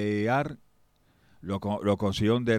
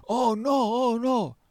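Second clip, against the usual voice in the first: high alarmed oh no; alarmed cry; 13 semitones higher, 3 dB louder, much slower than usual. Acted. Minister realizes the danger, alarmed high cry. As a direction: gasp out a sudden fright.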